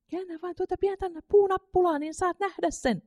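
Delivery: high, coaxing tempter's voice, softening and slowing at the end; tempting whisper. A narrator's reading. A tempting voice whispers in Katri's ear, high coaxing whisper. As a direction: lure her toward the cupboard. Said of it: "Viikotteleva ääni kuiskasi".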